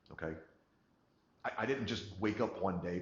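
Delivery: ordinary talk, nothing marked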